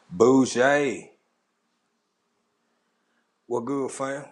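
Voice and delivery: usual speaking voice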